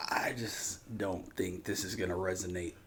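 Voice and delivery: hushed imitation of a narrator giving up; mock whisper. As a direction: whisper it like a quiet defeat. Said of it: "he's like".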